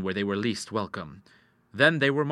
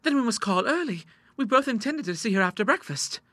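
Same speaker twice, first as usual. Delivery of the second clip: put-on much higher-pitched girlish voice; eager young daughter; 12 semitones higher than usual. A narrator's reading. Daughter answering her father at breakfast, eager and high.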